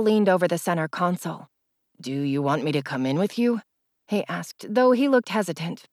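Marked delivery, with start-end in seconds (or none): 2.0-3.6 lightly voiced boyfriend, lower; a hint of a gentle young man; male voice. A narrator's reading. Male character voiced in story.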